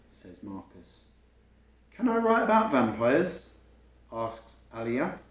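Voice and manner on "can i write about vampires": put-on eager little girl. Alia voiced by reader.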